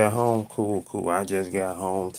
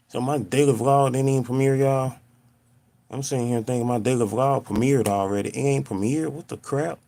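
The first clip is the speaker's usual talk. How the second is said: ordinary delivery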